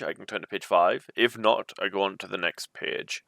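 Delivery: plain reading voice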